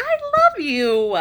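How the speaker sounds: high, slow, tender; tender love. Retold or imitated. Telling her playful soul she loves her, high and tender. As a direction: melt into it.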